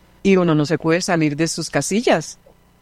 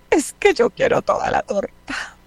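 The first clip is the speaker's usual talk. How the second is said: put-on much higher, whiny little girl's voice; 13 semitones higher than usual. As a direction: play the upset small child who wants all the cake. Teacher voices crying little girl. (voz aguda de niña pequeña caprichosa)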